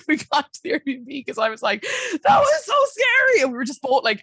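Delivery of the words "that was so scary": high, loud, slow mock-panicked cry; panicked shriek. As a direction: wail it out like you're frazzled. Retelling panic after snowstorm drive.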